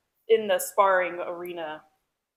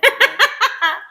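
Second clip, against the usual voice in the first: laughing squeal; 12 semitones higher, 10 dB louder than usual. Real, not acted. Laughing while quoting.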